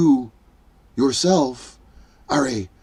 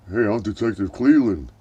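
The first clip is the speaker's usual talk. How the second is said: lower, gruff-leaning cop voice, only a hint; lightly voiced detective; 5 semitones lower, 3 dB quieter than usual. Detective Cleveland introducing himself with a lightly put-on cop voice. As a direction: introduce yourself like a tough cop. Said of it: "And then he goes".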